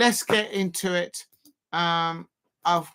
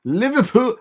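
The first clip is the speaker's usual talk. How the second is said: louder, amateur stab at a Scouse accent; attempted Scouse accent; 2 semitones higher, 6 dB louder than usual. Shown as a demonstration. Fan tests a Scouser accent on the team name, attempted Scouse. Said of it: "How do you do a, a Scouser accent".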